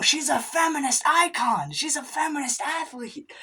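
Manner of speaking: high loud panicked cry to Margaret Sanger; frantic high plea. Acted. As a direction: scream it up high like you just realised. Frantic plea to stop abortion.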